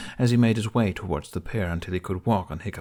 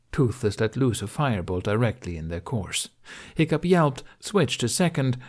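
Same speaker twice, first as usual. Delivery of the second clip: plain reading voice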